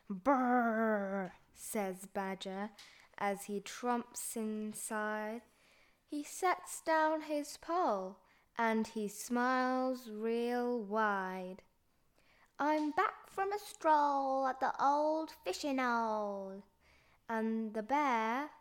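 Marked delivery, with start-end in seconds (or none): 0.1-1.3 cold shiver sound; shivering brrr. Acted. 12.6-16.6 lightly voiced cheerful badger; a hint of a jolly badger. Badger speaks.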